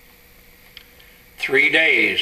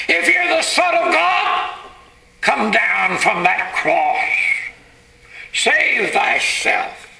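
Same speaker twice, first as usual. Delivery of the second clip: high, drawn-out jeering at a victim; mocking scoffers; 7 semitones higher than usual. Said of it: "mocking and scoffing and blaspheming".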